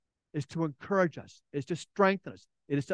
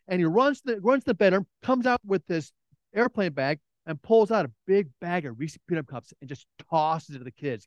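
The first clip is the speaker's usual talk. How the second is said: usual speaking voice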